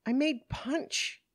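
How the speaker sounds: high, drawn-out, defensive answer; slow it down and pitch it up, defensively; defensive protest. Acted. Woman asked by police about drinking, defensive protest.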